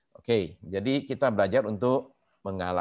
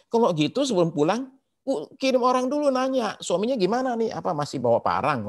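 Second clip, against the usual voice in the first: alarmed retold advice; 11 semitones higher, 4 dB louder than usual. Pastor retells own cautious reply.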